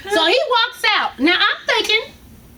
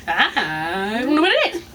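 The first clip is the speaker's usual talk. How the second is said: slow, much lower mock doctor, through a laugh; 8 semitones lower, slower than usual. Mocking the ER doctor's answer, low and slow. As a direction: drag it out low, laughing at him. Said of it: "tell me".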